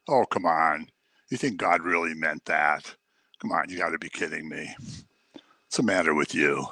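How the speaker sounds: low, soft tempter's voice; sly tempter. Acted. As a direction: coax them into doubt, low and quiet. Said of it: "The voice that Eve heard".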